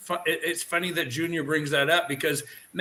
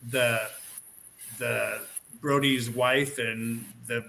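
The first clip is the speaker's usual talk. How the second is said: usual speaking voice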